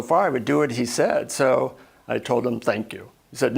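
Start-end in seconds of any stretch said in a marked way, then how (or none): 2.6-3.1 low, soft perfunctory thank you; half-hearted thanks. Acted. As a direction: say thanks without putting heart into it. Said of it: "You have to say, 'Thank you' from your heart".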